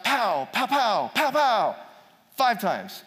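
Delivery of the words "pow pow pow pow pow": rapid mouth-made gunfire, high and punchy; imitated gunshots. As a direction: bang out "pow" like rifle shots. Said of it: "then he shot five".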